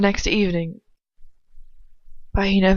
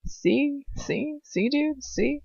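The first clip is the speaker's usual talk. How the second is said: higher, teasing repeated see; mocking herself for the mix-up, higher and teasing; mock insistence; 7 semitones higher, 4 dB quieter, much slower than usual. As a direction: needle them like a know-it-all. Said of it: "and I was like".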